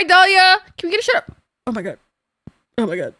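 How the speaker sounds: normal talking voice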